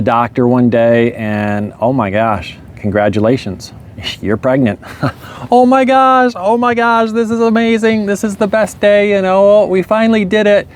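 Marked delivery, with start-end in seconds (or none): 5.5-10.7 high, squealing with joy; overjoyed. Retold or imitated. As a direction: gush like she finally got her wish. Wife overjoyed at pregnancy news.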